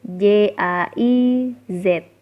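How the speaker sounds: "J A I Z" spelled slowly, letter by letter; dieja. Shown as a demonstration. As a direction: spell out "J A I Z" with spaces between letters. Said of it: "G-nya dibaca J". ("J A I Z" dieja pelan huruf demi huruf)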